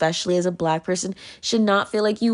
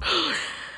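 a sudden high gasp; sharp gasp; 8 semitones higher, 5 dB quieter than usual. Real, not acted. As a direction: catch the breath in shock. Crying over own fear.

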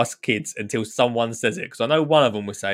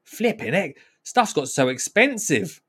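higher, slow shocked flipping heck; shocked exclamation; 6 semitones higher than usual. Retold or imitated. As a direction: gasp at the prices. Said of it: "I was like".

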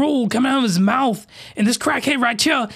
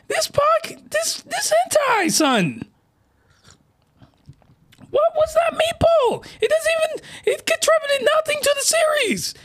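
falsetto rant. Real, not acted. Still ranting about meatball.

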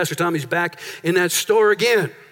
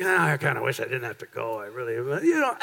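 reluctant whine; 10 semitones lower, 6 dB quieter than usual. Retold or imitated. Churchgoer dreading Sunday service, low reluctant grumble. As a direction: lower and softer, grudging.

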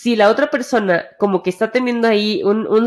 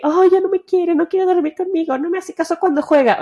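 high, whiny, self-pitying put-on voice; mock insecure girlfriend; 8 semitones higher than usual. A girlfriend fretting that her gamer boyfriend ignores her, mock whiny high voice. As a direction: pout it out like a neglected partner. Imagined insecure partner complaining.

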